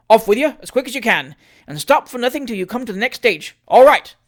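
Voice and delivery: high, loud commands; shouted orders. Acted. Mayor sending the chaise off, shouted orders. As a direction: send the chaise off loudly.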